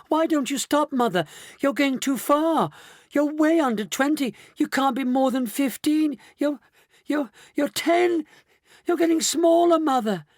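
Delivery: very high, panicky pleading; shrill alarm. A narrator's reading. Daughter to her shrinking mother, shrill alarm. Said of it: "a shrill note of alarm in her voice".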